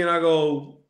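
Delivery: ordinary talk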